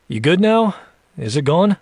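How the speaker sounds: high, offhand questions; dismissive. Acted. Jack done with the spider fuss, dismissive. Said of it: "he asks dismissively".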